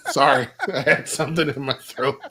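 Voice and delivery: sheepish laugh through the words; chuckling it off after coughing; embarrassed giggle; laughing apology. Real, not acted.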